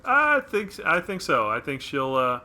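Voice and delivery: usual speaking voice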